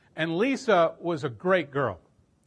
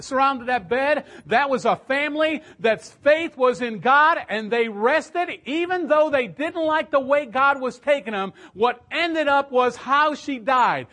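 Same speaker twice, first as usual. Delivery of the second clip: higher, louder, slow preaching climax; impassioned preaching; 8 semitones higher, 6 dB louder, slower than usual. Acted. Preacher to young people, slow and fervent. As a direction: build it to a fervent peak.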